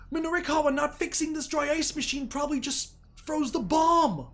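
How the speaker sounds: real high, loud burst of amazed realisation; excited shouting. Real, not acted. Player realises the dry ice machine froze the bomb, excited shouting. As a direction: shriek it out as the twist clicks. Player reacts to plot twist.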